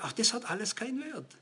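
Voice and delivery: leise, abgeklärt seufzend zitiert; resigniert leise. Retold or imitated. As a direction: Salomo müde und gleichgültig leise sprechen lassen. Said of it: "er sagt". (leiser, resignierter Salomo-Ton)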